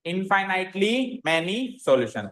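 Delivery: very slow, each word drawn out for copying; slow dictation. Shown as a demonstration. Teacher dictating the answer to the class, slowly. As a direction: dictate it slowly so students can write. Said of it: "solution कैसा होगा?".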